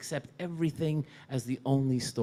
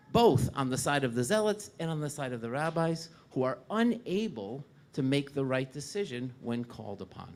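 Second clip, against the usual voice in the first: normal talking voice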